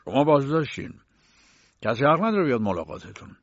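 loud words round a mouthful of food; acted full-mouthed announcement, pushed out loud. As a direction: talk loudly while still chewing. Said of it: "فرانس با دهان پر گفت".

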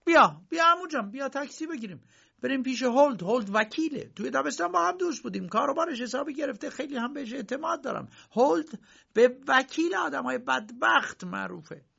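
high, hurried eager uncle. Acted. Uncle hurrying Josef off to lawyer Huld, high and eager. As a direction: rush him to a taxi.

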